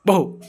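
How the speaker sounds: little woof; dog bark. Pretend. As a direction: bark like a puppy. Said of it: "പട്ടി കുരയ്ക്കുന്നത് എങ്ങനെ?". (കുഞ്ഞ് പട്ടി കുര)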